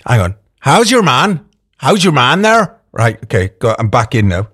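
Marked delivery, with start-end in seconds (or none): 0.6-2.8 lilting Northern Irish catchphrase, pitched up; put-on Northern Irish with rising lilt; Northern Irish accent. Shown as a demonstration. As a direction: sing out a Northern Irish practice phrase. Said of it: "I gotta sing my phrase".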